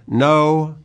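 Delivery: a mad king's sudden protest; mock-royal cry of denial. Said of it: "cries out".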